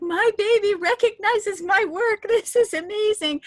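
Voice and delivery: re-enacted giddy amazement; thrilled outburst. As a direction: gush like a proud mom. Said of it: "I was like".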